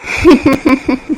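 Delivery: short scornful laugh; a bitter, hurt chuckle. Acted. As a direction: laugh in disbelief. Bitter laugh amid quarrel.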